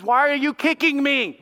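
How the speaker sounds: high-pitched mock indignation; lightly voiced complaining donkey; protesting donkey. Donkey asking Balaam why he kicks, mock indignant.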